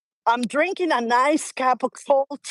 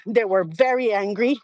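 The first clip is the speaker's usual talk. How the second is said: ordinary delivery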